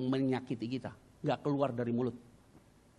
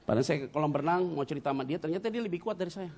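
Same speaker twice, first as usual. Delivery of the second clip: usual speaking voice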